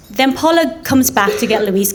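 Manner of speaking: ordinary delivery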